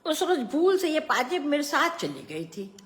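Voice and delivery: high, character voice of the aunt; aunt's explanation. A narrator's reading. Aunt confessing she took the anklet by mistake, high voice.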